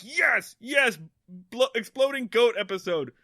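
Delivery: high-pitched re-enacted glee; retold excitement. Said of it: "why I got so excited about this".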